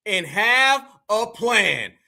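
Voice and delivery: slow, drawn-out mocking echo of "And have a plan". Host mocking [name]'s immigrant advice, slow drawn-out echo. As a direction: repeat her words slowly to mock them. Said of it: "straight immigrant one oh one".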